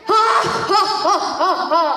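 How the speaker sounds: booming mock-giant laugh; hammed-up villain laugh; giant's laugh. A narrator's reading.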